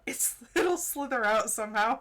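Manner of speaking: laughing through the words. Real, not acted. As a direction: read it while cracking up. Laughs while reading.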